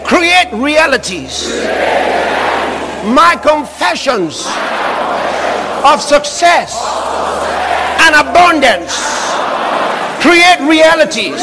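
slow, loud proclamation; booming declaration of faith; full-force preaching. Real, not acted. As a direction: drive each phrase out slowly and forcefully for the crowd to echo.

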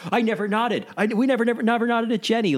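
high-pitched indignant mock denial; Vinny protesting. Backing up Pauly against Jenny's claim, mock indignant protest.

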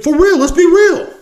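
high strained exasperation; exasperated outburst. Real, not acted. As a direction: throw up your hands and plead.

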